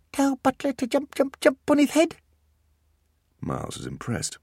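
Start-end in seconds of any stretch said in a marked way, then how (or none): 0.1-2.2 put-on high eager little-boy voice; a tiny child reaching for treats; toddler voice. Beckett mishears shelves, high and eager.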